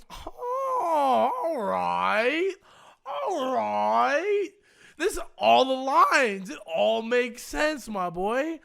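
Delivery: high, loud, slowed mock acceptance; mock understanding. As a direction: play along, dripping with sarcasm.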